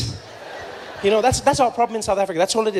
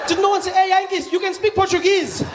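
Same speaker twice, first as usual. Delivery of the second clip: incredulous shout; 10 semitones higher than usual. Retold or imitated. Mock outrage question to audience.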